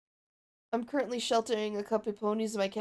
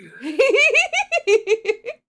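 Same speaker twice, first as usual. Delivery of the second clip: high cackling laugh; 13 semitones higher, 12 dB louder than usual. Acted.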